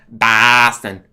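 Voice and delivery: put-on slow, loud drawn-out Boston accent. The boss explaining the speech impediment in a slow, loud Boston accent. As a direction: lean hard into a Boston accent.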